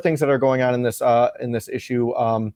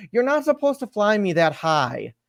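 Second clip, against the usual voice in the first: put-on scolding little girl; 8 semitones higher than usual. Tara scolding her dad.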